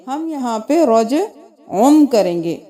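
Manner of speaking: usual speaking voice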